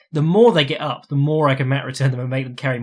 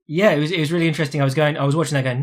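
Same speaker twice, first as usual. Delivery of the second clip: ordinary talk, nothing marked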